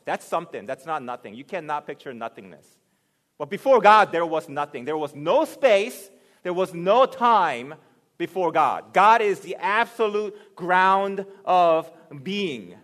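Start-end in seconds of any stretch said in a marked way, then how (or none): 3.4-12.8 loud, rising sermon voice; booming pulpit delivery; preaching at full force. Acted. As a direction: preach it out to the back row.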